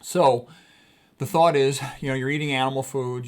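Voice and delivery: normal talking voice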